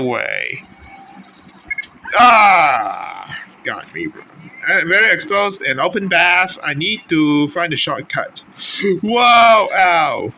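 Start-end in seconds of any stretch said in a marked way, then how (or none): 2.2-3.4 loud shout as you get caught; sudden loud yelp; pained shout. Real, not acted. Caught by enemy. 9.0-10.3 high loud startled whoa, then ow; loud startled cry then ouch. Real, not acted. Surprised then hurt in game.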